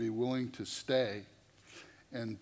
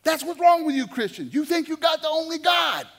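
much higher, much louder angry yell; furious shout; 12 dB louder than usual. Retold or imitated. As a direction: blast the accusation at him. She slams her fist down.

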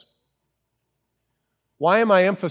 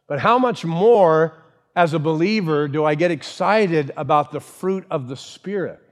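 ordinary delivery

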